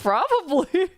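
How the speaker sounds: laughing reply. Retold or imitated. Streamer quoting her own reply, high and giggling. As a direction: say it while laughing. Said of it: "I was like".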